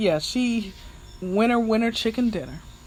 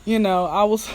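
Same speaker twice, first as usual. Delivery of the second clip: ordinary delivery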